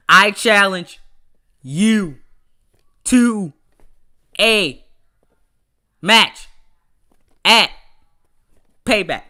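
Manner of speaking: "I challenge you to a match at Payback" in loud single words with long gaps; punchy, halting, over-punctuated reading; mock comma pauses. Shown as a demonstration. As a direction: stop dead after each word of "you to a match at Payback". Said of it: "that's what commas is. You pause".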